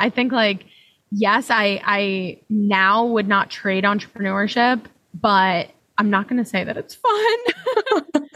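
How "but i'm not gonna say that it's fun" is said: slower, higher, tipping into a laugh; laughing admission. Real, not acted. Guest admitting entrepreneurship isn't fun, laughing. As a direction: draw it out and let the laugh break through.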